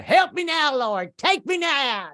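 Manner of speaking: high, loud, slow wailing cry to the Lord; hammed-up ecstatic high wail; mom's screaming prayer. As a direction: shriek it out like a mother in rapture. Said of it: "like, you know".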